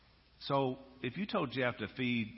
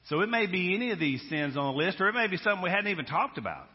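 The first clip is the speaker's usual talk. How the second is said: louder, higher preacher's cry; preaching at full force; 9 semitones higher, 6 dB louder, faster than usual. Acted. Preacher to congregation, ringing full-force preaching. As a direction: drive it home to the congregation.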